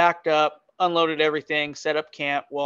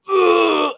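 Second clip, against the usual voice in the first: loud, high heave; imitated retching; 13 semitones higher, 6 dB louder than usual. Friend answering mid-vomit, loud mock retch. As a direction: mimic a friend being sick. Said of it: "starts throwing up".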